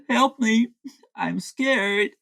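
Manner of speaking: mock scared. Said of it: "He is screaming help and I'm scared".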